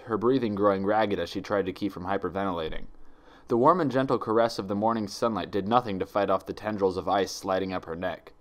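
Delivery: ordinary delivery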